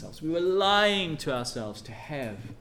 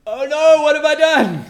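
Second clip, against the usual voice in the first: high, loud cry of horror; sudden anguished realization; dismayed cry; 13 semitones higher, 15 dB louder than usual. Retold or imitated. Penitent Israel realizing their sin, high anguished cry. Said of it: "and now they're going".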